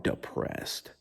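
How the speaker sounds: breathy, dropped-away whisper; deflated whisper. Retold or imitated. Telling viewers about Monday after the event, whispered gloom. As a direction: let the word collapse into a whisper. Monday morning back to work.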